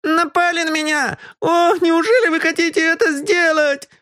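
put-on boy raging pitifully, very high; a slapped brat howling in outrage; pitiful rage. Slapped boy rages at the detective, pitiful fury. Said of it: "бушевал он в жалком гневе".

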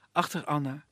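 usual speaking voice

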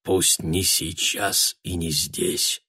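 slow, very soft but distinct; barely audible. A narrator's reading. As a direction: say it almost inaudibly, yet clearly. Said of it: "едва слышно, но отчётливо произнёс".

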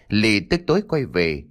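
usual speaking voice

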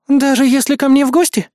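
high-pitched indignant question. A narrator's reading. Азуми objecting to going home, indignant. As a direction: protest in a high voice. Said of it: "возмутилась Азуми".

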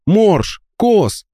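high calls to her sleeping friends; calling out. A narrator's reading. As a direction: call their names, pitched high. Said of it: "позвала она ребят".

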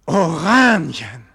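slow, loud anguished call of the name; anguished cry. Acted. Arrested Egmont calling his absent friend, slow and loud.

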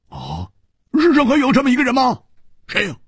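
high, fast, exclaiming question; loud astonishment. A narrator's reading. Hu amazed such a person exists, loudly asking. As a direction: burst out in high-pitched disbelief. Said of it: "胡铁花大声说".

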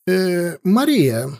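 high hesitant bleat. A narrator's reading. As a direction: bleat it out timidly. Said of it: "проблеял кронпринц Фердинанд".

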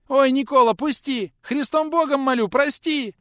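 high terrified squeal. A narrator's reading. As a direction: shriek and beg in panic. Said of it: "заверещал тот". (испуганный визг)

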